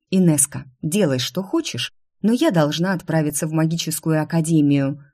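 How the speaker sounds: ordinary delivery, nothing marked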